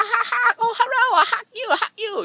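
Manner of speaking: high, fast, gushing hello to fans; hammed-up eager hugger voice; mock fan greeting. Greeting fans who ask if he's Peter Chow, high and gushing. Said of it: "And I go".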